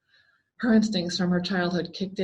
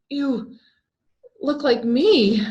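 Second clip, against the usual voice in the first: high, louder, slow exclamation of shock; exclaiming; 6 semitones higher, 6 dB louder, much slower than usual. A narrator's reading. Jessica recognizing her own face on the clown, shocked exclaim. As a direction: realize it with a jolt. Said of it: "she exclaimed".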